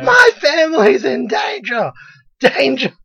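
high, loud hysterical shriek; mock screaming. Woman at the beach protecting family, hysterical scream. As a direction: shriek it like a panicked, hammed-up mother. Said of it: "all you see is her screaming".

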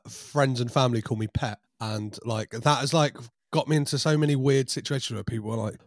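normal talking voice

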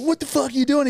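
high-pitched indignant confrontation; retold outraged yell. Driver jumps out at the man he hit, outraged. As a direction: imitate an outraged driver, voice shooting right up.

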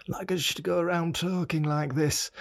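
put-on high-pitched old man with a northern accent; hammed-up northern old-timer, pitched well up. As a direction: put on an elderly northern English voice. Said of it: "old me has a northern accent".

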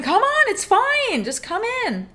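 mock enticing siren call; luring friend. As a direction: call sweetly from under the water. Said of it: "And she's going".

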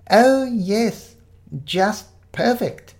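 put-on high, drawn-out delight in an old voice; quavery elderly vicar. Fake vicar admires the big tree, high and quavery. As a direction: savour it like a doddery old vicar. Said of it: "in a quavery voice".

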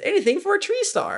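reading a fan's review, imitating a baby dinosaur character; cartoon dinosaur kid. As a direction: squeak it like a tiny cartoon dinosaur. Quoting the children's dinosaur movie.

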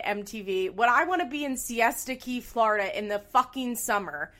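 loud, indignant rant with hard-hit swear words; worked-up, high-energy complaint; exasperated rant. Real, not acted. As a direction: vent in disbelief, punching key words. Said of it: "there's my little rant".

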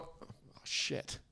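breathless, voiceless gasp of awe; hushed. Pretend. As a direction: whisper it as if struck dumb. Stunned reaction to a Spanish speaker.

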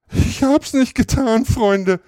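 viel höher, weinerlich klagend; schluchzend. A narrator's reading. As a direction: schluchze die Unschuld heraus. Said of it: "schluchzte er". (schluchzend, viel höher gesprochen)

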